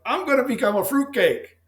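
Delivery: high, loud mock proclamation; wry self-mockery. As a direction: announce your own folly with a grin. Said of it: "I was like".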